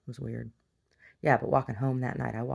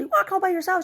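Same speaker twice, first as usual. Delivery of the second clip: much higher, loud, alarmed; neighbor voice; 8 dB louder than usual. Retold or imitated. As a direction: scold her like a worried auntie. Neighbor voiced, high put-on.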